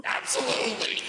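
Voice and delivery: demonic voice. Pretend. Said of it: "demon vampire party boy".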